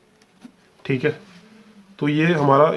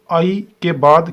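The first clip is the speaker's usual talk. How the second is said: slow, raised and louder on आई के बाद; emphatic explanation; 3 semitones higher, 6 dB louder, much slower than usual. Shown as a demonstration. Teacher to students, stressing आई के बाद slowly and loudly. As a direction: lean on आई के बाद to drive the point home.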